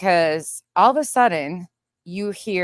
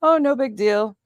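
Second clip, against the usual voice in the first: higher, breezy imitation of unconcern; mock chill; 7 semitones higher, 4 dB louder than usual. Boyfriend brushes off an elk warning, mock chill. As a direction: wave it off lightly. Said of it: "And he's just chilling".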